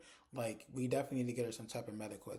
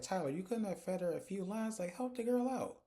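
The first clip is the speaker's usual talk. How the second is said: exasperated plea; 7 semitones higher than usual. Real, not acted. Exasperated at the actress.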